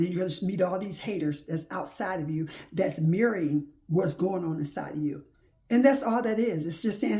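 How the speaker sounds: normal talking voice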